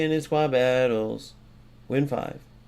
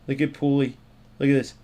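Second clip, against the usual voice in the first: ordinary talk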